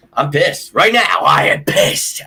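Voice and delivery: angry fan venting; real anger. Real, not acted. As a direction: lean hard into each word.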